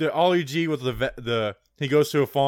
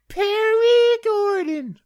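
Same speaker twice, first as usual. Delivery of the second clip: very high, loud, stretched-out yell; drawn-out announcer holler; 7 dB louder, much slower than usual. Pretend. Host thanking a show supporter, hammed-up high holler. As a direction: holler the name slowly, way up high. Sponsor name hollered, then said normally.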